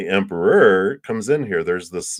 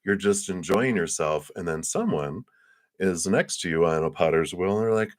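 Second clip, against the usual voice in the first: ordinary talk, nothing marked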